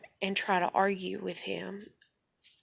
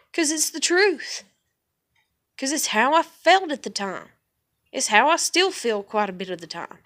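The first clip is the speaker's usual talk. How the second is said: loud, higher, emphatic defiance; real defiant outburst; 5 semitones higher, 6 dB louder than usual. Real, not acted. Answering a threat to share her video, loud and defiant. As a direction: hit each phrase hard, unashamed.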